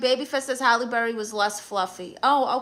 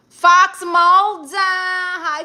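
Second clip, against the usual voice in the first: drawn-out name call; 8 semitones higher, 9 dB louder, much slower than usual. Real, not acted. Calling out to the viewer Fox Mulder, loud, slow and high.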